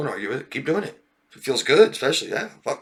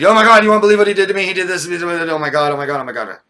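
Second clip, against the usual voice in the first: fast, high, loud gushing that fades out; mock dramatic; 7 semitones higher, 8 dB louder than usual. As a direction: gush like breathless gossip. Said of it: "they come out".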